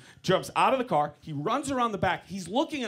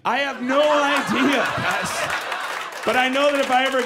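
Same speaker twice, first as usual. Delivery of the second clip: exclaimed admission; 3 semitones higher, 4 dB louder than usual. Acted. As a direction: belt it out to the crowd, stretched. Outburst after the dad punchline.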